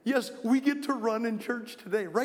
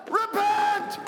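repent yelled much higher and louder; 10 semitones higher, 4 dB louder than usual. Real, not acted. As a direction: shout repent as the stop signal. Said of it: "I'm gonna yell a word".

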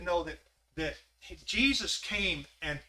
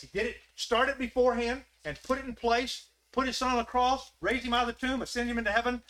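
raised, louder sermon delivery; full-force preaching; rising preaching; 7 semitones higher, 5 dB louder than usual. Acted. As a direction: preach it higher and louder as it builds.

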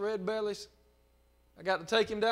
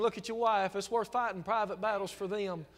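ordinary delivery

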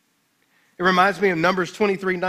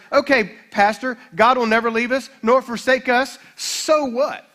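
louder, higher mock challenge; skeptical listener; 5 semitones higher, 6 dB louder than usual. A doubting listener challenging the pastor, loud and skeptical. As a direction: play a doubter asking so what. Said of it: "In other words".

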